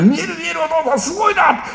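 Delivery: put-on high, eager child's voice; excited kid. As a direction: gush like an amazed little boy.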